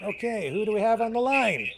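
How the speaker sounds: high, squeaky voice. Real, not acted. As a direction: pitch the voice up into a squeak. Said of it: "I've got my high squeaky voice on".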